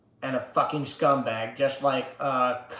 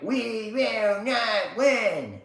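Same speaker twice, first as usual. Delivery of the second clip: slow, higher, drawn-out chant; his own version; 7 semitones higher, much slower than usual. Retold or imitated. Streamer answering the politician with his chant, slow and defiant. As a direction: stretch each word like a defiant chant. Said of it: "That's my version".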